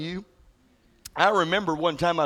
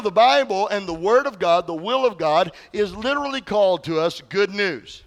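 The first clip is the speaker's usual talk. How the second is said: ordinary delivery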